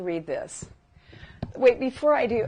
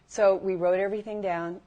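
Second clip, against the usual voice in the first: ordinary delivery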